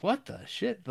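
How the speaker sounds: high, incredulous outburst; baffled. Retold or imitated. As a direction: react like the edit makes no sense. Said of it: "there's the one that's like".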